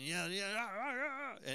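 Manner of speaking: mock yelling at no great volume, climbing in pitch; imitated yelling daughter. Daughter yelling back at calm dad, understated mock yelling. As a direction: lightly mimic a kid's rising rant. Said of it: "she would get yelling".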